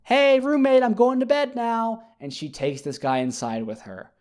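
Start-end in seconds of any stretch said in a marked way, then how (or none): 0.0-2.0 high, calling out to the roommate in another room; sing-song high call-out, mock cheerful. Woman calling back to her roommate from outside: high sing-song call. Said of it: "she screams back to her roommate".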